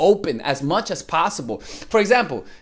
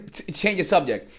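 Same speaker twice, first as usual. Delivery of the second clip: ordinary delivery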